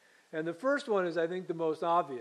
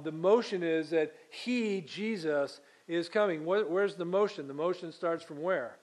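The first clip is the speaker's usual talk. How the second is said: usual speaking voice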